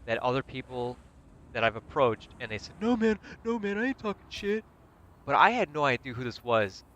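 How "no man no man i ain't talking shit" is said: high-pitched, pleading mock denial; backing down. Imitating people he once confronted, high pleading denial.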